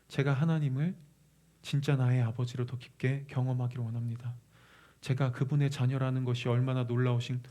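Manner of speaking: low, hushed supplication; earnest prayer. Shown as a demonstration. Preacher showing the congregation how to ask the Spirit, prayerful. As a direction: pray it softly and evenly, phrase by phrase. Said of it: "이렇게 간구하세요".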